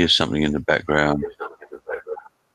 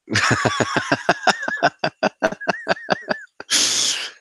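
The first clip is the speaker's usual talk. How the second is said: long, high-pitched laughing fit. Real, not acted. Streamer reacting to a chat message, long high laugh.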